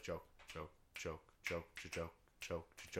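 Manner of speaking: low, quiet, steady patter; soft relentless run with no breather; nonstop stream. Shown as a demonstration. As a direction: keep them ticking along under your breath. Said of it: "This is just like".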